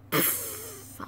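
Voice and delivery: scornful breathy scoff; dismissive pfft. Acted.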